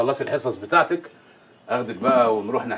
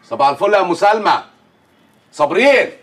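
calling out; 8 semitones higher, 4 dB louder than usual. Acted. Calling up to Sabreen from outside, loud and high. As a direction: call her name loud and high. Calling neighbour across house.

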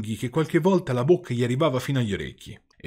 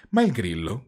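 plain reading voice

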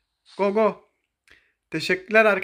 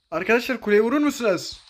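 usual speaking voice

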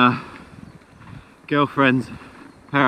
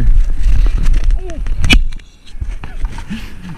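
loud laugh; 7 semitones higher, 11 dB louder than usual. Real, not acted. Laughing mid-sentence.

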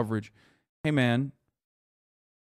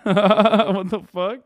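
laughing disbelief; 7 semitones higher, 9 dB louder, much slower than usual. Real, not acted.

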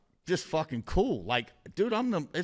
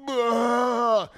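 long, loud, high retching groan; imitated violent heave; 9 semitones higher, 6 dB louder than usual. Man showing how violently he was sick, imitated heave. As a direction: mimic a violent, noisy heave. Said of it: "it's so loud".

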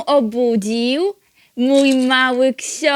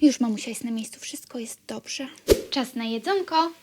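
hushed, breathy comfort; calm the baby in a whisper; soothing whisper; 12 semitones higher, 10 dB quieter than usual. Acted.

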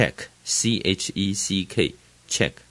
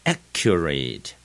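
accurate slowed, strong AC; stress on first syllable; 4 semitones lower, slower than usual. Shown as a demonstration. Teacher modelling new words, accurate stressed on AC. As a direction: hit the A of accurate, let the rest fall. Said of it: "前面就是重音，a很重".

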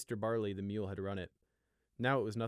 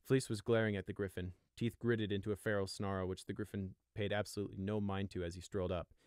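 ordinary delivery

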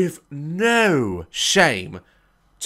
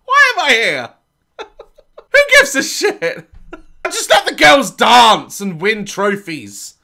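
laughing, louder mock rant; exasperated dance mum; 6 semitones higher, 5 dB louder, slower than usual. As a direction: play the fed-up mum who gives up. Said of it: "that woman's me".

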